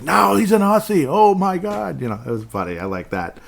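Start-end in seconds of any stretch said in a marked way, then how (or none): 0.0-2.0 much higher and louder, delighted; retelling his friend's amused outburst, high and loud; excited. Retold or imitated. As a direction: squeal it out, amused. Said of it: "He's like".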